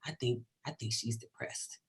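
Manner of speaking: soft, hushed whisper; child whispering to mom on the subway. Retold or imitated. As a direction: lean in and whisper it. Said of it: "she'll lean over and whisper".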